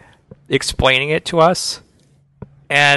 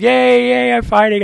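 high, drawn-out mock cheer; high singsong "yay" in imitation; 12 semitones higher, 3 dB louder than usual. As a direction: imitate a gleeful fighter, high-pitched and sustained. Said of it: "as far as he's like".

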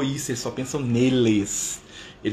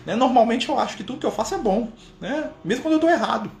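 high-pitched mock doubting question; pressing questioner; 10 semitones higher than usual. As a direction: push the point in a high voice. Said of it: "O Kardec pressionava".

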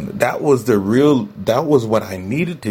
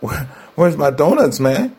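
higher, cheeky craving voice; body's cravings talking; 6 semitones higher than usual. Retold or imitated. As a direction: voice your body begging for donuts and soda. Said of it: "my body was like".

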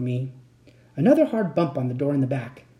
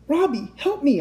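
high-pitched cry for help; high, urgent plea; desperate cry; 11 semitones higher than usual. A narrator's reading. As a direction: call out in panic. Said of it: "I cried".

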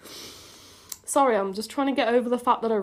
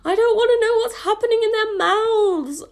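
loud, high cringing wail; appalled outburst; 11 semitones higher, 7 dB louder, slower than usual. Real, not acted. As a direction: wail it, stretching the words in horror. Appalled by own kissing description.

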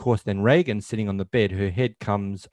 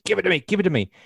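quick, higher-pitched acted insistence; urgent demand; 10 semitones higher, much faster than usual. Chris grabbing the crucifix from Reagan, imitated urgent demand. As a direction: imitate a frantic mother demanding it.

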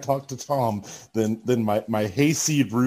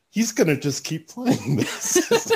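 laughing; 6 semitones higher, slower than usual. Retold or imitated. Retelling his thought, laughing.

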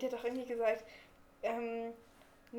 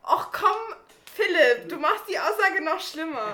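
loud, high laughing reproach; laughing protest; 4 semitones higher, 10 dB louder than usual. Real, not acted. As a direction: laugh while scolding him.

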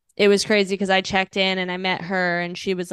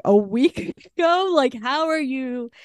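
imitating her friend, higher and slower with a laugh; shocked disbelief; 6 semitones higher, 3 dB louder than usual. Rehab friend hearing it was a week ago, in stunned disbelief. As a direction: echo it back as if you can't believe it.

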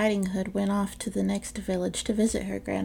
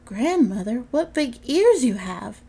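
put-on slow, louder, amazed child; the girl gasps at the wolf in granny's bed, slow and louder; wide-eyed wonder; 4 semitones higher, 6 dB louder than usual. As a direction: marvel at each strange feature.

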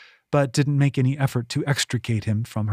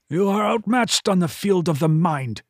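put-on menacing old man; 5 semitones higher than usual. A narrator's reading. Villain speaks into her head.

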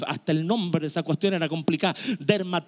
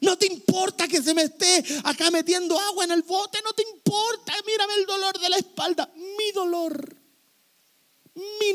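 high, pleading self-pity; whiny complaint; 12 semitones higher than usual. Acted. Preacher mocking a believer complaining to God, high whiny plea. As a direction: grumble to God about every ache.

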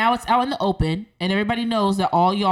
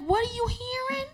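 high, softer puzzled squeak; baffled question; 8 dB quieter than usual. Retold or imitated. Answering a woman who says she can hear it, baffled. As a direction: ask it way up high, as if bewildered. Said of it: "I'm like".

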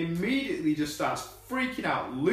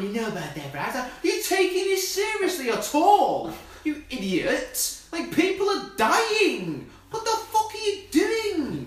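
exasperated rant; 8 semitones higher, 3 dB louder than usual. Retold or imitated. Hero berates the porter, mocking exasperated yell. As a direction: rant at the useless sidekick like a furious hero. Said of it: "He's there with a bra going".

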